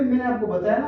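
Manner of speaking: ordinary talk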